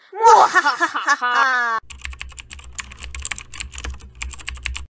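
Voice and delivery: loud evil cackle; mad scientist laugh. Acted. Scheming over ruling YouTube.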